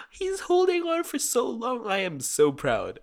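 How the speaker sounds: gasp, then very high, slow squeal of pride; gushing proud parent. Acted. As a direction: coo over the pet like a thrilled parent. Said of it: "I'm, like, a proud parent".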